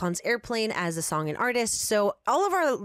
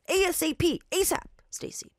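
impatient; 9 semitones higher than usual. Acted. Impatient man presses clerk.

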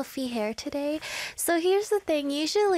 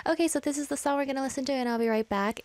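fast, rushed DJ handoff; rushed radio link; faster than usual. Shown as a demonstration. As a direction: hurry through the song intro. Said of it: "this is what I would do".

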